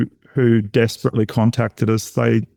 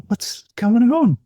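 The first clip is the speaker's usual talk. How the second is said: bewildered; 12 semitones higher, slower than usual. Retold or imitated. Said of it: "looked at each other and said".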